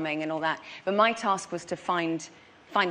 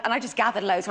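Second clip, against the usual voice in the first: normal talking voice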